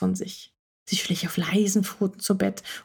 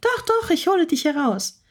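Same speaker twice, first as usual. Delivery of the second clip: higher cat voice, insistent on "Doch, doch"; insisting; 8 semitones higher than usual. Acted. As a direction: insist stubbornly she'll do it. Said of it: "beharrte Frieda erneut". (beharrlich, höhere Katzenstimme)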